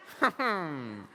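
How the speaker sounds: villainous cartoon skeleton chuckle; Death. Shown as a demonstration. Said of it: "go-to line to get Death is".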